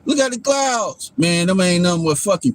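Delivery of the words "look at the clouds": higher, slow, mock awe; mocking gawking people. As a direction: drag it out like a starstruck fool. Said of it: "People like".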